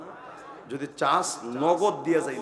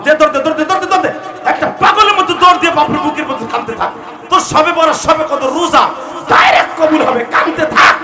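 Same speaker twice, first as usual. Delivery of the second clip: high, loud urging shout; fiery preaching; 14 semitones higher, 18 dB louder than usual. Real, not acted. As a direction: whip the crowd into running, full force. Preaching at full force.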